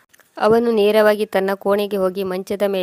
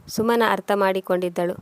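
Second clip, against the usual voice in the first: ordinary delivery, nothing marked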